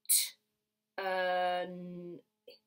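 "T, uh, n, ip" as separate sounds; sounded out. Shown as a demonstration. Teacher segments a word for children, sounded out "T, uh, n, ip". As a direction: say "T, uh, n, ip" sound by sound, with gaps. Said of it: "only makes one sound".

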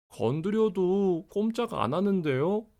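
put-on high, anxious child voice; a little boy fretting about his pet; worried child. (걱정하는 아이 목소리)

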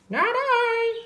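very high pig squeal-voice; storybook pig. A narrator's reading. Pig refuses to help plant, very high character voice. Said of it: "said the pig".

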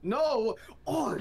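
furious gamer yell; raging gamer. Real, not acted. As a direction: scream at the screen in rage. Player dies, yells in rage.

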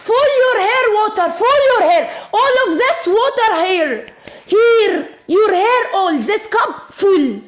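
high, giggly squeals while laughing; gleeful laughing squeals as the water goes on; excited squealing laughter. Real, not acted. Child laughs as water pours.

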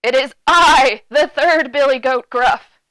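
booming, loud big goat. A narrator's reading. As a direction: proclaim it boldly as the biggest goat. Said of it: "his voice was big and as loud".

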